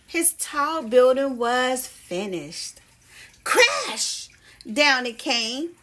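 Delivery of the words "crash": a big, high crash sound. A narrator's reading. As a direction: burst out with the crash, high and loud. Tower of blocks falls down.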